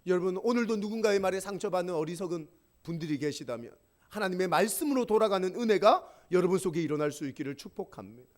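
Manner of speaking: usual speaking voice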